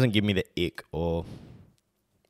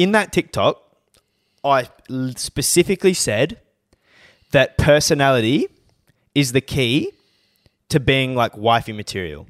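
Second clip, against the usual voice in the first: higher, emphatic rant in broken chunks; episode rant; 4 semitones higher, 5 dB louder than usual. Real, not acted. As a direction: hammer the point home with pauses. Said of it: "This is my rant for the episode".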